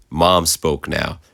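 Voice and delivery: usual speaking voice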